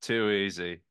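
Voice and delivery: lightly mimicked Aussie catchphrase; fake Australian accent. Repeating the waiter's only catchphrase in Australian accent. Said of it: "all he had was".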